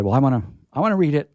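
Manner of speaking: normal talking voice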